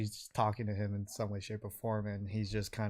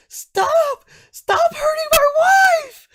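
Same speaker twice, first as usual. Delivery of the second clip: very high, full-force wail; desperate husband's scream; 18 dB louder, slower than usual. Acted. Man screaming at an orc to save his wife, desperate. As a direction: scream it desperately, shrieking far above your voice. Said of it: "And then you hear".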